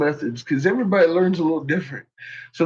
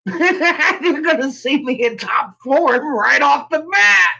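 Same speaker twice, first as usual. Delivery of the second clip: laughing; 8 semitones higher, slower than usual. Real, not acted. Laughing about viral video.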